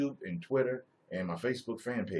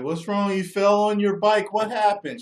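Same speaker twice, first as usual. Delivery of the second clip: loud, high worried father; concerned dad; 12 semitones higher, 10 dB louder than usual. Pretend. Father comforting his little girl after a bike fall, worried. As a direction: rush to the fallen child.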